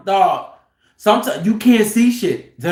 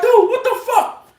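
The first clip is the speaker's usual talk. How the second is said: hollering; 13 semitones higher than usual. Retold or imitated. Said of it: "dog, he hollering".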